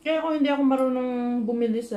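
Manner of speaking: food in mouth; talking between bites; speaking while chewing. Real, not acted. Seen 0: Bite taken at -5; jaw and lips still working while speaking.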